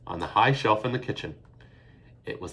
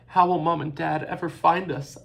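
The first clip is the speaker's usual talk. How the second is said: sobbing; 8 semitones higher, 6 dB louder than usual. A narrator's reading. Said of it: "Eilish sobbed again".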